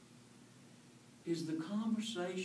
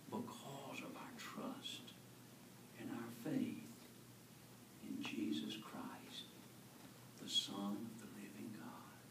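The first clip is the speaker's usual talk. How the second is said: reverent whisper; 3 semitones lower, 10 dB quieter than usual. Real, not acted. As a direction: hush the words in faith. Whispered close of the thought.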